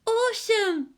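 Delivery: put-on very high, excited child's cry; squeaky delighted child; little girl's yell. A narrator's reading. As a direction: shout like a thrilled kid. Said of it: "she yelled".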